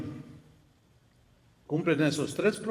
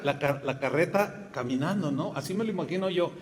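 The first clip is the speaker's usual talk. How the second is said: ordinary delivery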